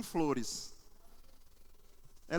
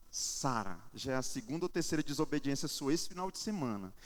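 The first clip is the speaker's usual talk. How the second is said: low, softer reprimand; stern father; 6 semitones lower, 5 dB quieter than usual. Retold or imitated. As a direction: reenact a dad's controlled telling-off. Pai repreende a filha. (bronca contida de pai)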